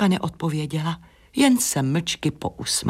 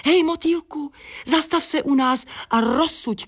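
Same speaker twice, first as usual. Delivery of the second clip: high-pitched hail to a passer-by; bright-pitched summons, not shouted; calling out; 11 semitones higher than usual. Acted. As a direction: call the butterfly over. Flower calls out to butterfly.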